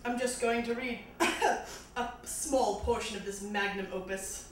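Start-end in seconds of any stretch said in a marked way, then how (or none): none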